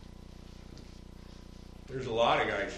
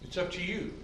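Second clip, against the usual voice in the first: higher, mocking echo of the offer; mocking quote; 7 semitones higher than usual. Pastor mocking the minister's offer, higher and scornful. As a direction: sneer at the idea lightly. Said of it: "the way he presented salvation".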